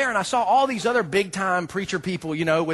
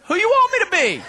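higher, louder playful comeback with a laugh; cheeky joking reply; 12 semitones higher, 5 dB louder than usual. Retold or imitated. Teasing the guard with a cheeky comeback. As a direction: toss it back with a grin. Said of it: "And I said".

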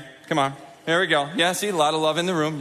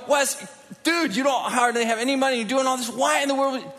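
incredulous friend; 8 semitones higher than usual. Retold or imitated. Friends questioning his spending, high incredulous shout. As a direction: call out a broke friend in disbelief. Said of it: "some of my friends would go".

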